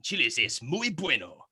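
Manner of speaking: slow put-on Mexican ad voice; Mexican accent. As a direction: draw it out in a mock Mexican accent. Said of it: "south of the border".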